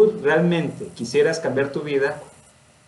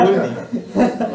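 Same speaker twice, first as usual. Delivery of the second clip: higher, through a laugh; amused, pitched-up word; laughing remark; 7 semitones higher than usual. Real, not acted. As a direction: toss it off laughing. Laughing remark about teasing.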